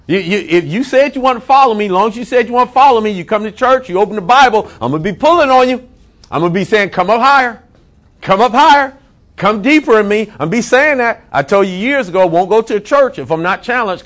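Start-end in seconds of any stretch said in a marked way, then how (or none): 0.1-6.9 fiery preaching. Acted. Pastor to his congregation, fiery preaching. Preaching at full force, voicing God. 6.9-8.9 mock call from God. God calling the believer upward. 9.3-11.2 fiery preaching. Acted. Pastor to his congregation, fiery preaching. Preaching at full force, voicing God.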